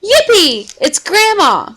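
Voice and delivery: Yippee shouted high and bright; thrilled cheer. A narrator's reading. Henry greeting Grandma at the door, thrilled cheer. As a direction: cheer like a delighted kid. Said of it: "said Henry".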